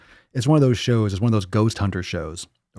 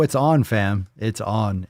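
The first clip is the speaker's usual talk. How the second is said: usual speaking voice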